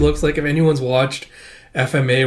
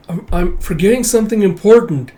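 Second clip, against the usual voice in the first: high, louder, stammering worry; troubled realisation; 7 semitones higher, 5 dB louder than usual. Acted. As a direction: voice a confused child reaching for a memory. Confused child with throbbing head.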